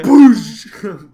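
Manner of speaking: loud burst of a mouth explosion; explosion noise. Retold or imitated. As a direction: blast it out like a cartoon bomb. Imitating an explosion.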